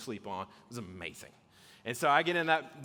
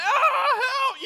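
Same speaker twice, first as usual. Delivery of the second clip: high, loud panicked scream; screaming for help; 10 dB louder than usual. Retold or imitated. Man zipped in his sleeping bag, screaming for help. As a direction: cry out helplessly, at full voice. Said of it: "you know, I'm screaming".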